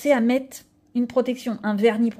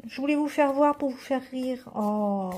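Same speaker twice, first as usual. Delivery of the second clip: ordinary delivery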